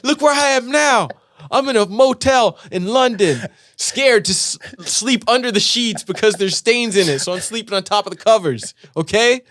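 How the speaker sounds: high, loud mock-defiant rant; defiant brag to parents. Comic talking back to Mom and Dad, mock defiant gloat.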